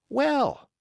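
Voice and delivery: put-on high, doubtful young seaman; young sailor. Young seaman doubting Silver's money, high. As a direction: push back, eager and high.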